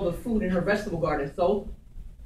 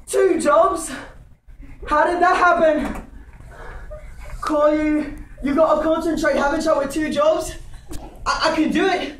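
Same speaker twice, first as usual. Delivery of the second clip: high, loud stage monologue; flustered comic character thinking aloud; excitable comic servant; 8 semitones higher, 4 dB louder, slower than usual. Acted. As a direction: talk yourself up, loudly and high-pitched.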